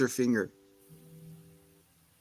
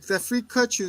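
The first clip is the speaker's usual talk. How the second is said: much higher indignant question; mock outrage; 11 semitones higher than usual. Boyfriend confronting her, much higher indignant voice. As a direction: act scandalised. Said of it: "He's like".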